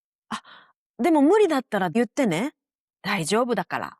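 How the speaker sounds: invitation with a fast tacked-on way out; 息継ぎなしで早口に付け足す; quick soft add-on. Shown as a demonstration. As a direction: rush the buffer in the same breath. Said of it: "you add, almost in the same breath".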